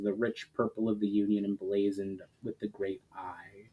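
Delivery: usual speaking voice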